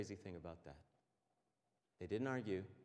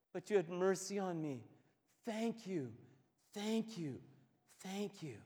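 higher and louder, fervent thanks; overwhelmed gratitude; 8 semitones higher, 10 dB louder than usual. Retold or imitated. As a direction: pour out thanks on your knees. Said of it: "He falls on his face before Jesus and says".

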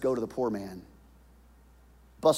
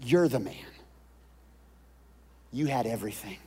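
normal talking voice

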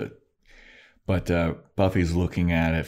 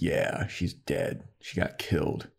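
breathy, slow and much softer; cold hushed delivery; 6 dB quieter, slower than usual. Retold or imitated. Riley bitterly confirming the death to Buffy, hushed and slow. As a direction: let it fall to a bitter near-whisper.

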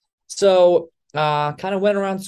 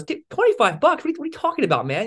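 incredulous scoff; 12 semitones higher, faster than usual. Retold or imitated. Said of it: "he laughs in my face".

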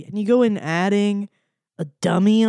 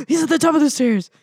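mock alarm; 7 semitones higher, 4 dB louder, faster than usual. Acts out whipping round in fright.

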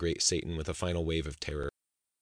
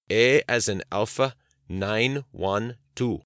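louder, deliberate restatement with long gaps; slow, emphatic; 4 semitones higher, 5 dB louder, much slower than usual. A narrator's reading. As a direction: spell it out very slowly, each item separate. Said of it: "slowly restated his request more emphatically".

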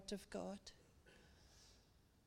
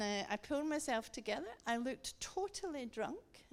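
usual speaking voice

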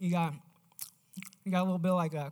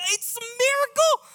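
high, loud shriek of joy; a servant girl squealing good news; overjoyed; 8 dB louder than usual. Acted. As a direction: burst out with delight. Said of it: "And Rhoda's like".

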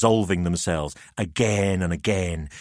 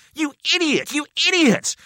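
high, loud angry cry; furious outburst; 3 dB louder than usual. A narrator's reading. Narrator raging at Jamie after the head sinks, furious cry. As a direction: rage at him.

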